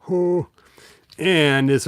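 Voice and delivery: ordinary delivery, nothing marked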